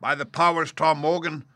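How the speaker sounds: high, threatening landlord voice; exclaiming. A narrator's reading. Landlord warning Tom Morgan, exclaiming. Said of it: "exclaimed the landlord".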